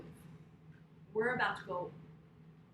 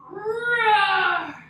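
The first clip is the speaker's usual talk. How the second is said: big loud bear roar, higher; 7 semitones higher, 14 dB louder than usual. Shown as a demonstration. Yoga teacher to kids in bear pose, loud roar. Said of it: "let's roar for the bear".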